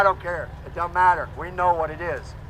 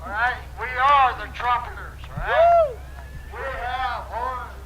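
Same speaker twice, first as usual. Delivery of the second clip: rally speech; 7 semitones higher than usual. Real, not acted. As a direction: fire up the crowd. Rallies crowd, trumpeters.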